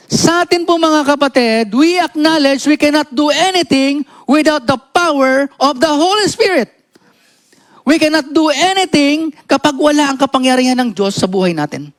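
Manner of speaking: high-pitched, insistent sermon delivery; emphatic preaching. Real, not acted. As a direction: drive the point home to the congregation, pitched high. (mataas at madiing pangangaral)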